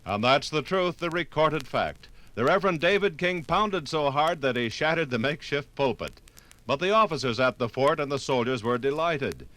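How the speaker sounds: ordinary talk, nothing marked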